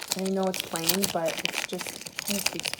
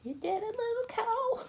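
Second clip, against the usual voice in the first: much higher squeaky coo to a toy. Real, not acted. Cooing at cute cow bead.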